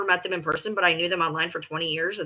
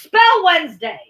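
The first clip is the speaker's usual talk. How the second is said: mocking challenge; 9 semitones higher, 8 dB louder than usual. Real, not acted. Quizzing partner mockingly.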